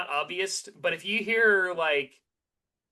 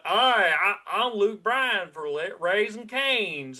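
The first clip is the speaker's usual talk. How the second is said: thick, mushy Southern drawl; slow mock Southern accent, words smeared and stretched; 3 semitones higher, much slower than usual. Country star pitching chicken in an ad, thick mushy Southern accent. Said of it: "it's so thick and mushy in how he talks".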